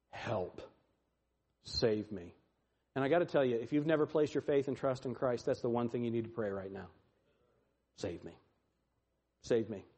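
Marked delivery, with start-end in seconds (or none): 0.1-2.3 low, soft, bare plea; quiet plea. Acted. Someone in distress praying to be saved, quiet plea. Short prayer of one in distress. 8.0-9.9 very low, hushed prayer; soft, spaced-out appeal, falling away; quiet plea. Acted. Sinner praying to Christ right now, quiet plea.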